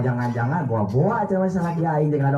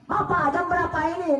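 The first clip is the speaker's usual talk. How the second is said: nagging wife; 9 semitones higher than usual. Retold or imitated. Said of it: "Nanya jam".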